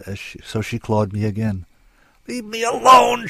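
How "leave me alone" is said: high, loud shriek. A narrator's reading. Struggling woman to the man gripping her hair, furious shriek. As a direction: scream it to drive him off. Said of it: "she shrieked".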